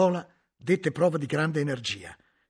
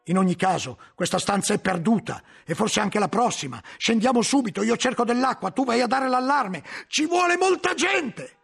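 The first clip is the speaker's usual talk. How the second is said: loud, climbing ever higher as orders pile up; urgent orders; 8 semitones higher, 6 dB louder than usual. A narrator's reading. Guglielmo ordering the novice to raise the alarm, building loud orders. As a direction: bark commands faster than fear.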